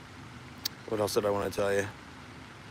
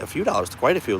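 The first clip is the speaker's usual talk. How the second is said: ordinary talk, nothing marked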